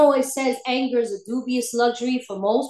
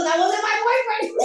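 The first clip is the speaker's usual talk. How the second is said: much higher, astonished realisation; high-pitched exclaim; 8 semitones higher than usual. Retold or imitated. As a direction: blurt it out in shock. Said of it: "I told my sponsor".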